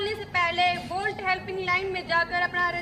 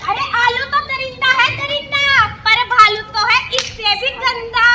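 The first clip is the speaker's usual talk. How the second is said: loud, raised-pitch comeback; heated retort; 2 semitones higher, 6 dB louder than usual. Acted. Rival supporter running down the bear symbol, loud quarrelling. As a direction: fire back at the rival camp.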